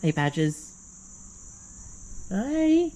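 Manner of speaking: high, soft cooing to a pet; pet-talk coo. Real, not acted. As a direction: coo sweetly at the little pet. Greeting pet.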